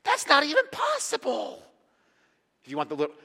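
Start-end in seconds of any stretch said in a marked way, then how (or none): 0.0-1.7 high, loud acted outburst; hammed-up emphatic refusal; emphatic denial. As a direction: shut the idea down, voice pitched way up. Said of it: "he gives this emphatic".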